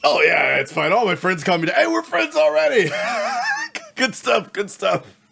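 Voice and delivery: high, loud, eager innkeeper voice; overly friendly desk clerk, straining upward; cheery hotel clerk. Acted. GM plays Teddy the clerk.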